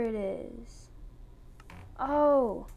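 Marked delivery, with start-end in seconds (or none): 2.0-2.7 sudden loud exclamation; loud surprised oh. Real, not acted. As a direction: blurt it out, startled. Sudden discovery.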